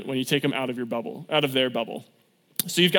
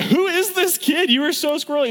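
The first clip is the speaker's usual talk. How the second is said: incredulous leaders; 12 semitones higher than usual. Retold or imitated. Youth leaders marveling at squirrely Zach, high incredulous mock. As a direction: pitch it way up, baffled by the kid. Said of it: "We're like".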